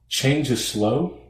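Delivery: ordinary talk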